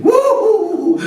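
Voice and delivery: high hooting laugh; mock hooting laughter; mocking boyish laugh. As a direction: hoot with glee like teasing boys. Boys laughing at Krishna.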